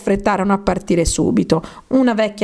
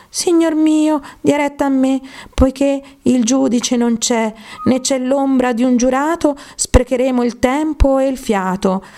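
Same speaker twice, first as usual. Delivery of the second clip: a small mouse pleading politely with the dog; little mouse; 7 semitones higher than usual. A narrator's reading. Mouse's reply in the poem. (topolino con vocina acuta)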